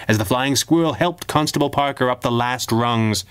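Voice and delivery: ordinary delivery, nothing marked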